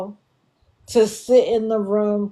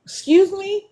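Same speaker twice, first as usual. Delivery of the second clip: shocked excuse me; incredulous; 11 semitones higher than usual. Real, not acted. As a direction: ask it in disbelief. Incredulous at paying for Dash Pass.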